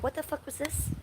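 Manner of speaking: high, startled question. Real, not acted. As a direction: jump at something strange. Startled by something.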